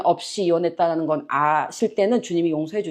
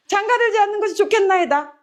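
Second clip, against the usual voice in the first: mock dismay; 11 semitones higher than usual. Disciples' dismayed reply, acted.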